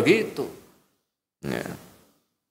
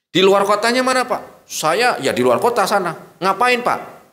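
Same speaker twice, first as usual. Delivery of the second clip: interrogation exchange; 9 semitones higher, 3 dB louder than usual. Acted. Questioning the lying official.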